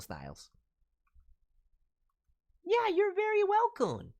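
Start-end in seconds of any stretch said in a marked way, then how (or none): 2.6-4.1 squeaky character voice. Acted. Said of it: "squeaky voiced reply".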